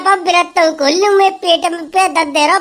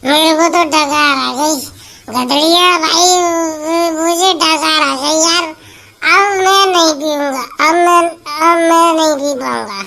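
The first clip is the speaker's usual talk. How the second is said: put-on drunk man. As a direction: speak like you've had too much. Drunk man slurring after drinking. (नशे में धीमी लड़खड़ाती आवाज़)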